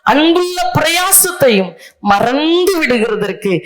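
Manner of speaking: preacher hammering the verse home word by word; slow emphatic re-reading. Real, not acted. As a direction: lay each phrase out slowly for the congregation. Re-reads verse slowly for emphasis.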